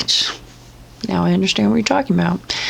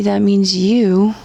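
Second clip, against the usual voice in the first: ordinary delivery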